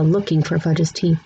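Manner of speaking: usual speaking voice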